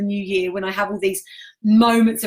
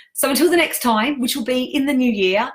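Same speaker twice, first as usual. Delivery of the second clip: bright sign-off; 5 semitones higher than usual. Real, not acted. Upbeat sign-off to the group.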